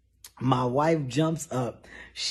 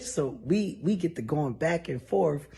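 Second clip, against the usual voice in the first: ordinary talk, nothing marked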